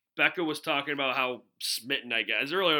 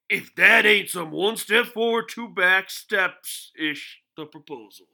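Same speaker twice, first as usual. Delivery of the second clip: louder, higher, slow pundit punchline; Stephen A Smith; 4 semitones higher, 6 dB louder, slower than usual. Retold or imitated. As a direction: stretch the phrase out like a fired-up TV pundit. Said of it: "read it in a Stephen A Smith voice".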